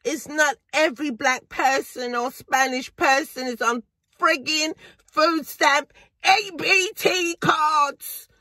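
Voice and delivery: ordinary talk, nothing marked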